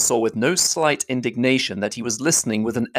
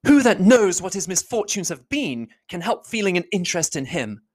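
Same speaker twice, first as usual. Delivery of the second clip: higher voice, earnest and heated; a higher heroine's voice, defending him warmly; Elizabeth, heated; 8 semitones higher than usual. Acted. Elizabeth defending Wickham to Darcy, higher and heated.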